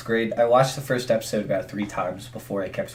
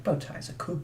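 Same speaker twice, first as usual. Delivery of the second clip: higher, softer imitation of British speech; British accent; 6 semitones higher, 11 dB quieter than usual. Quoting Matt Smith's catchphrase to a friend, imitated British accent. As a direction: lightly mimic a British catchphrase. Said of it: "he'd always say it with his, like, British accent".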